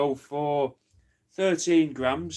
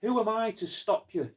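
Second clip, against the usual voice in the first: much higher voice, helpless innocence act; mock deferential reply; 9 semitones higher than usual. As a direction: pitch it way up as if powerless. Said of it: "you never looked at him going like this".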